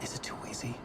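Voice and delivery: breathy near-whisper, barely voiced; hushed whisper. Writer whispering his fears to himself. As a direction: murmur it to yourself in dread.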